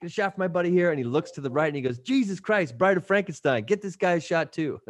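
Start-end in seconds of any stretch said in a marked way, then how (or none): 0.0-0.9 lightly acted, higher-pitched bar order; imitating a buddy calling out for drinks. Scotty orders drinks from the bartender, higher-pitched mock order. 2.0-4.8 high-pitched exclamation at a stranger; imitating a buddy's startled wisecrack; mock astonishment. Buddy spots a giant at the bar, high mock astonishment.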